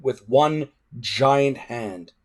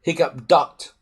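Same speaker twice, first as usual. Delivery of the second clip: ordinary delivery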